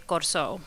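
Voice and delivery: Corso said the island Creole way, "kor-SO"; Portuguese Creole pronunciation. Shown as a demonstration. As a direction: say Corso as local Creole speakers do, two syllables. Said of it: "in a Portuguese Creole".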